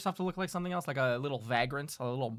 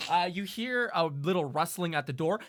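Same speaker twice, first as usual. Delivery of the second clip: ordinary talk, nothing marked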